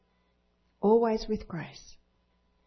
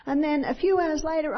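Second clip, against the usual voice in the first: ordinary delivery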